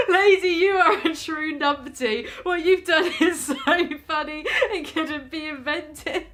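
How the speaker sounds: loud, high, slow laugh-reading; laughing through words. Real, not acted. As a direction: read it while cracking up.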